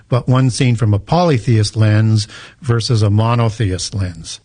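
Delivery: normal talking voice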